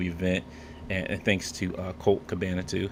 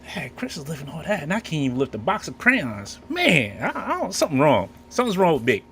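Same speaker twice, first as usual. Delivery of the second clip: higher-pitched, mildly hammed-up lament; raised-pitch mock dismay at yourself; self-mocking lament; 7 semitones higher, 4 dB louder than usual. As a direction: play up feeling weak and useless. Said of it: "I'm like".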